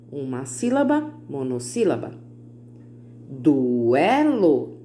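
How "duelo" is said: "Duelo" stretched as du, e, lo; three linked, drawn-out beats on "Duelo"; syllable by syllable. Shown as a demonstration. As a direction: lengthen every syllable of "Duelo". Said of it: "três sílabas".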